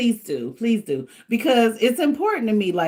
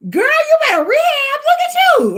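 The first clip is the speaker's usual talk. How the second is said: much higher, louder mock voice of her son; son teasing; 7 dB louder than usual. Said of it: "My son was like".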